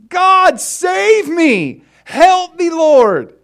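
very high, loud cry for help; desperate screaming. Retold or imitated. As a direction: yell for rescue while hanging. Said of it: "And he's screaming".

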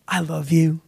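soft, reassuring fatherly voice; tender father. Acted. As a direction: say it gently, as unconditional reassurance. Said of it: "to hear from their father".

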